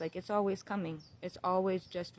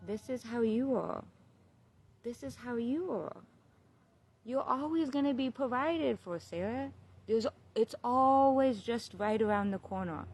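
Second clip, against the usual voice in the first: higher-pitched, reassuring inner voice; little inner voice; 5 semitones higher than usual. Retold or imitated. Little voice promising Sarah she'll be provided for, reassuring. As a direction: speak as a reassuring voice from within, pitched up. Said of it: "the little voice says".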